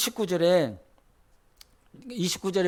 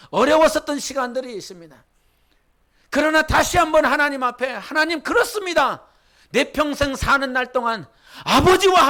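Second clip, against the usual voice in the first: louder, rising preacher's exhortation; preaching; 4 semitones higher, 4 dB louder than usual. Acted. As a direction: drive the blessing home to the congregation. Sermon climax.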